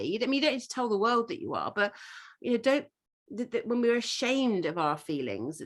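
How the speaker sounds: ordinary delivery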